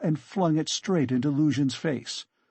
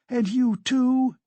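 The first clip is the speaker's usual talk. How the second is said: high, drawn-out indignant question; outraged accusation; 11 semitones higher, 5 dB louder, slower than usual. Acted. Katerina turns on landlady.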